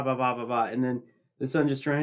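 ordinary talk, nothing marked

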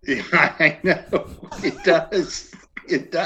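laughing while agreeing; real laugh through the words. Real, not acted. Laughing at the hard left turn.

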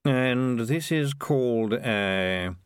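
very slow, stretched-out words; disdainful piper. Acted. Piper examines Knopf's sausage, slowly disdainful. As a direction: draw every word out, unhurried.

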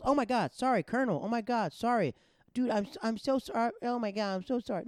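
very high, loud flustered sorry; panicked apology. Retold or imitated.

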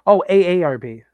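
ordinary talk

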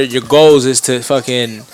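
ordinary delivery

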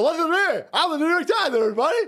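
high, preening mock voice of the New York Times; a hammed-up high prissy voice; mock pompous newspaper. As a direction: preen like a vain old lady. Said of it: "And they go".

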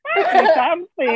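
breathless with laughter; after laughing hard. Real, not acted. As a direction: still giggling. Laughing at the start of call.

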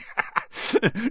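a quick amused laugh; chuckle. A narrator's reading. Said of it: "Hagrid chuckled".

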